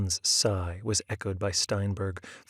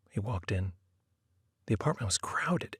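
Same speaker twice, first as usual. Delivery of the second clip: ordinary delivery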